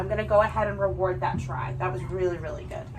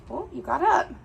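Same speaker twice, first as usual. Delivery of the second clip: much higher, light praise; high dog praise; 9 semitones higher than usual. Real, not acted. Trainer praising her border collie, sweet and high.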